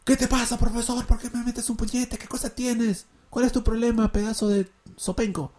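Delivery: mock offended student, unplanned and natural; spontaneous angry student. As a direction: blurt it out like a student who was just hit. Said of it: "me vas a decir algo de manera espontánea".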